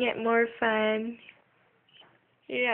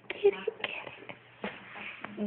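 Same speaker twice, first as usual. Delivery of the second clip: whispered, much softer and slow; excited whisper; 8 semitones higher, 9 dB quieter, much slower than usual. Real, not acted. Parent whispering encouragement as she grabs the toy. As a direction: egg her on in a hushed whisper. Urging pet to grab toy.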